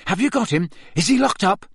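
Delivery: put-on a boy pressing his teacher, frantic; frantic Harry. A narrator's reading. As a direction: fire the questions in a panic.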